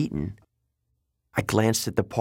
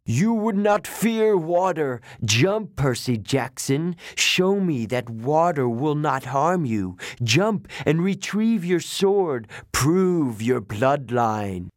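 higher, slow serpent challenge; hissing snake lady; 4 semitones higher, slower than usual. Acted. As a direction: drag each word out, daring him to jump. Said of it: "Echidna hissed".

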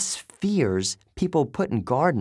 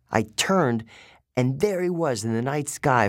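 ordinary delivery, nothing marked

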